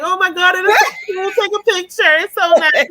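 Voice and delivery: high, tearful, overjoyed crying; crying on the phone. Retold or imitated. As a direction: retell the call through happy tears, pitched high. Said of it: "on the phone crying".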